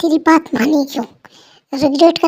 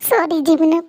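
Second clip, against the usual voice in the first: ordinary delivery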